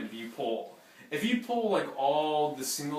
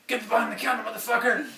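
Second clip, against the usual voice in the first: higher, harder voice acting out a robber's threat; mock robber threat; 9 semitones higher, 4 dB louder, faster than usual. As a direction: bark it like the gunman in the story.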